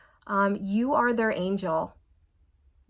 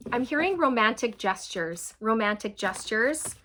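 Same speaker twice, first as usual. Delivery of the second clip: usual speaking voice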